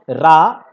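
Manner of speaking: रा held out long and loud; long drawn vowel. Shown as a demonstration. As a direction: stretch the आ of रा as far as it goes. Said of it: "बहुत लंबा खींच दिए". (रा को बहुत लंबा खींचकर)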